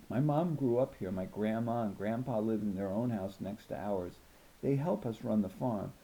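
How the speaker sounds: usual speaking voice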